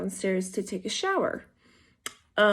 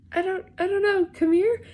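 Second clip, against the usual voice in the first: high, raised-voice urging; retold urgent call; 11 semitones higher, 5 dB louder than usual. Husband urging his unclothed wife to come, high urgent call. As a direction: imitate a flustered summons, pitched way up.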